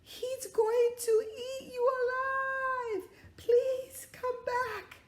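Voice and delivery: very high, loud desperate begging; pleading shout. Acted. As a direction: beg them to come back. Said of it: "shouting out the window".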